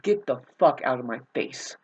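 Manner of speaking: normal talking voice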